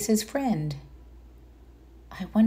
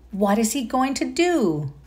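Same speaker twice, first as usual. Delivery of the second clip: plain reading voice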